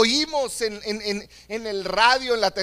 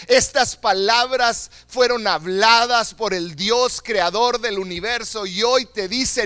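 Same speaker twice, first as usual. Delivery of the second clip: ordinary talk, nothing marked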